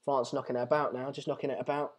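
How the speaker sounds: low, very soft mock commentary; dull, hushed imitation of earlier remarks; quoting himself. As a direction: drop to a quiet mumble for the repeated line. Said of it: "count how many times I said".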